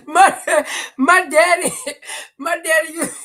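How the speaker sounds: high, loud laugh-cracked words; can't stop laughing. Real, not acted. Breaks up laughing telling story.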